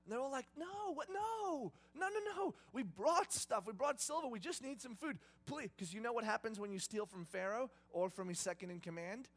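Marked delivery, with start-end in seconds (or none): 0.6-5.7 high, frantic protest; flustered denial, pitched high; panicked pleading. Retold or imitated. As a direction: beg in a rising panic, voice way up. Brothers pleading before Joseph.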